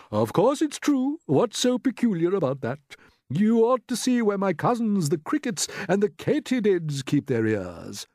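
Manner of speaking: put-on emphatic old grasshopper. A narrator's reading. Grasshopper telling doubting James about cousins, emphatic. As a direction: insist grandly, swooping high.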